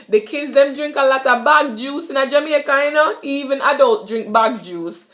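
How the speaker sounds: Jamaican patois. Shown as a demonstration. Teacher giving a patois bag juice example. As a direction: speak it like a Jamaican kid. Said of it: "Basically, I said the kids".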